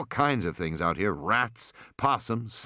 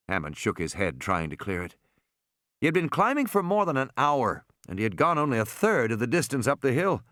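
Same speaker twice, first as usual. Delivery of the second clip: plain reading voice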